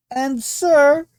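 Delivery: high, loud call; shouting over noise. A narrator's reading. Guest yelling to the host at dinner, loud. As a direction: shout into his ear over the din. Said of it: "screaming in his ear".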